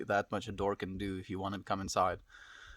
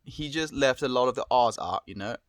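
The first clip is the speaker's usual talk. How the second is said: louder British accent, pitched slightly up; amateur attempt at a British accent; 2 semitones higher, 5 dB louder than usual. Shown as a demonstration. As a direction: put on a British accent on request. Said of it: "can you do a British accent".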